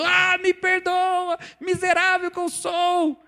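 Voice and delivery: high, slow, pleading whine; mock groveling sinner. Fiel ajoelhado implorando ao irmão, lamento exagerado e agudo. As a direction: grovel on your knees, hammed-up. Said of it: "você quer ver ele de joelhos, chorando".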